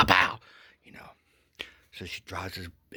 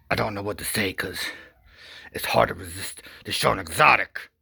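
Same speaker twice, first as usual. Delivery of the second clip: normal talking voice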